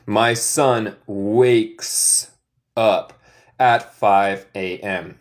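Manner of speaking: very slow, word by word; slow model sentence. Shown as a demonstration. Teacher repeating the answer for the class, very slow. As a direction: lay each word down for learners. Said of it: "Wakes up significa despertarse en inglés".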